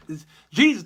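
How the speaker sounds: ordinary delivery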